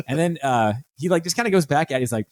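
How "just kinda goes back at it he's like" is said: ordinary talk, nothing marked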